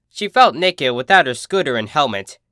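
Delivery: plain reading voice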